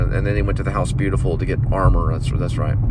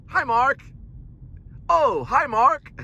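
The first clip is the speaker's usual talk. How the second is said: high mock Wiseau greeting, oh hi Mark; a cult-film quote performed; hammed-up Wiseau hello; Tommy Wiseau line; 3 dB quieter, slower than usual. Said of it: "That's when it came out".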